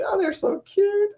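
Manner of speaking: high gushing "so cute"; reacting to the couple's videos, gushing. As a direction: coo over the couple. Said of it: "I was like".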